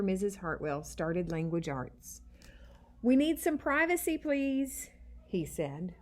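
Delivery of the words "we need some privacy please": put-on eager child request. A narrator's reading. Eddie asks class privacy.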